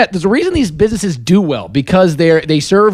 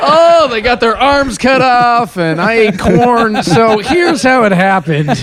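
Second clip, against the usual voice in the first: mock explanation; 6 semitones higher, much slower than usual. Mocking friend's explanation.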